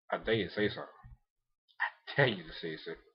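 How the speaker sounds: quiet, low, teasing menace; low taunting dare. Retold or imitated. Recapper voices Ogami's look at her, a hushed taunt. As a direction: dare them under your voice. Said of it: "He gave her that look like".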